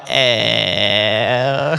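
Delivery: low, croaky eh like a rattling note; imitated rattling noise. The note rattling on the fan, a low croaky eh. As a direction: croak it out like paper buzzing on a fan. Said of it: "made this noise that sounded a lot like".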